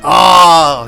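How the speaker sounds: sudden loud oh; loud startled exclamation; surprised outburst. Real, not acted. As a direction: caught off guard by the screen. Reacts to fire in game.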